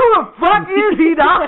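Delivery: loud, high-pitched incredulity; hammed-up, flabbergasted outburst; comic exasperation. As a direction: exclaim it in mock outrage. Incredulous at brother's excuse.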